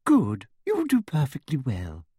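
sugary high-pitched official; sweet, simpering and high; Umbridge voice. A narrator's reading.

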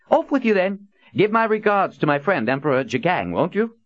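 high, loud cheerful send-off; breezy dismissal. A narrator's reading. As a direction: shoo them off with a grand flourish. Prophet dismissing the men.

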